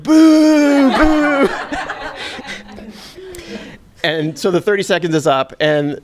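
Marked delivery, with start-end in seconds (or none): 0.0-1.5 high, louder laughing boos; mock crowd booing. Teller imitating eighteen thousand fans booing his air balls, laughing mock boos. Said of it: "eighteen thousand people are like". 1.5-2.6 a high, airy laugh; breathless real laugh. Real, not acted. Teller laughing after imitating the booing crowd, airy real laugh.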